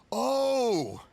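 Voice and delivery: sudden realisation. Acted. Father suddenly grasps a meaning.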